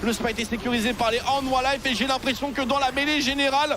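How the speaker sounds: fast rising play-by-play call; esports caster. Real, not acted. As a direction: call the teamfight as it builds. Live play-by-play building up. (commentateur esport survolté)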